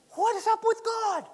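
high, slow, pleading disbelief; incredulous cry. Acted. People questioning God after defeat, high and incredulous. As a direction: lift it up high, slowly, bewildered.

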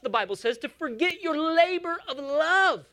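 ordinary talk, nothing marked

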